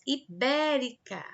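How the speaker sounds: teacher's ibérica, weight on the syllable bé; stress on bé. Shown as a demonstration. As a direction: punch the bé in ibérica. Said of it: "é a sílaba mais forte".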